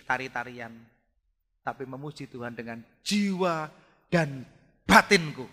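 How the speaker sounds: normal talking voice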